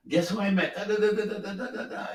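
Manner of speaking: put-on excited kid gushing. Son telling dad about meeting a star, gushing excitedly. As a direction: mimic a boy babbling about who he met.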